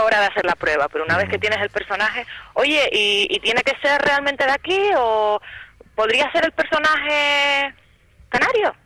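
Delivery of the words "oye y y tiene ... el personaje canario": higher, insistent questioning of a producer; challenging actor. Retold or imitated. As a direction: push back, voice rising to a peak on canario. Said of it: "poner los puntos sobre las íes y decir, oye".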